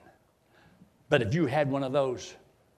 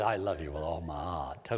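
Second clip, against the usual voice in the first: tender; 11 semitones lower, 7 dB quieter than usual. Shown as a demonstration. Saying I love you.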